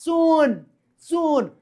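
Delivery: echoed soon like a film hero's entry; movie-style echo. Shown as a demonstration. Teacher echoing soon to students like a movie hero. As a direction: repeat soon, fading, like a South film dialogue. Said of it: "soon ત્રણ વાર બોલ્યા".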